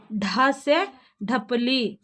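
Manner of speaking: ढ से ढपली stretched slowly, aspirated ढ clear; slow letter demo. Shown as a demonstration. Teacher to children: slowly sounds out the letter word. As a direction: sound it out slowly for young pupils. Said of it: "ऐसा लिखना है।".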